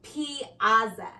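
usual speaking voice

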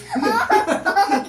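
high squealing laugh from tickling; being tickled. Real, not acted. Laugh during tickling countdown.

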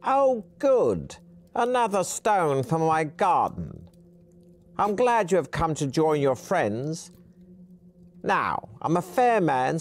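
put-on a mad old trickster threatening in a high voice; high-pitched old man. Narrator voices the old hermit taunting the heroes, high and loud. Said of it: "In a high-pitched voice".

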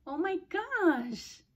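high, very drawn-out exclamation; real high, stretched-out amazement; overwhelmed delight. Real, not acted. Overcome by generous extra tights.

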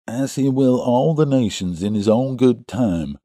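pious quoting through the nose; nasal voice. A narrator's reading. Second visitor chimes in piously, nasal. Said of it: "said the other in a nasal voice".

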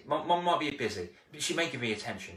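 ordinary talk, nothing marked

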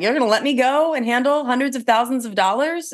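high, rushed mock panic; imitating her nervous younger self in disbelief; retelling her fear as a rookie agent, high and panicky; mock terrified. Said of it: "I was terrified".

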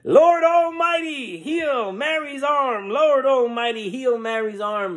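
high, drawn-out chanted plea; congregation praying in unison. Acted. Crowd praying for Mary's arm, high slow chant. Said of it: "start to sing and dance and pray".

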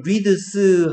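"reads" with D and S sounded separately; awkward pronunciation. Shown as a demonstration. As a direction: say "reads" in chopped Korean syllables, ri-deu-seu. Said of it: "하면 좀 어색한 발음이야". ("reads"를 '리드스'로 어색하게 끊어 발음)

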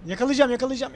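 real eager chase; determined excitement. Real, not acted. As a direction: push it out with eagerness. Chasing target in game.

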